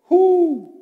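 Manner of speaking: high, loud, long hoots; ringing high owl call. Shown as a demonstration. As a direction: hoot like an owl. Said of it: "He was an owl".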